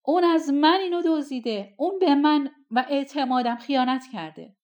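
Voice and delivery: loud, high, outraged cry; shouting accusation. A narrator's reading. Amir accuses the impostor before the sultan: loud, high cry. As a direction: hurl the accusation at the thief. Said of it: "عامر فریاد زنان گفت".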